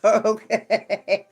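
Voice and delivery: okay said through a laugh; real laugh breaking up the word. Real, not acted. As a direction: chuckle your way through the word. Laughs at the card drawn.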